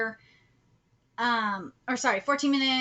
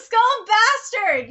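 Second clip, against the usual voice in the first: relished repeat; 7 semitones higher, 7 dB louder, much slower than usual. Real, not acted. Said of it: "I like the".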